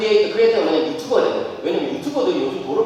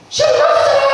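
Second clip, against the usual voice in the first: high, loud wailing complaint; a teenage son's loud, high-pitched protest; upset teen wail; 6 dB louder, faster than usual. Retold or imitated. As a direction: whine it out like a son in tears. Upset son crying over exam.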